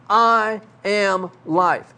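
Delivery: each word slowed and weighted; slow, solemn emphasis on "I am life."; slow emphatic statement. Shown as a demonstration. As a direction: lay out every word deliberately. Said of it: "you're trying to emphasize something".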